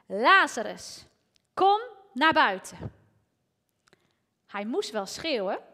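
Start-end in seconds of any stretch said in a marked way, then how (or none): 0.1-2.9 loud call. Retold or imitated. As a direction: call the dead man out of the tomb at full voice. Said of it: "riep hij met een luide stem".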